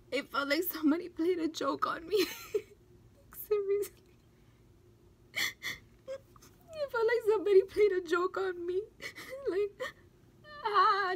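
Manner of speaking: high, loud, tearful protest; real crying pushing the words out. Real, not acted. Woman feeling cruelly tricked by her loss, crying. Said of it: "I don't even know why I'm crying".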